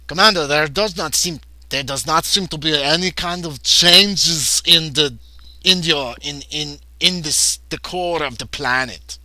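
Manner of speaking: put-on faint Russian accent on a crewman; attempted Russian accent, barely there. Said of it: "I used to be able to do a Russian accent really well".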